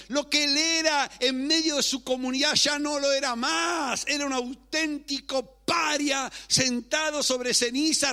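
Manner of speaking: high, loud, impassioned sermon delivery; full-force preaching. Real, not acted. Preacher recounting how Job lost everything, full-force preaching. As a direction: preach it out, driving each phrase upward.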